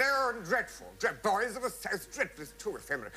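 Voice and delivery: high, disdainful mock officer; hammed-up appalled officer; scornful officer. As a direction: sneer at the song like a fussy commander. Officer mocks the song.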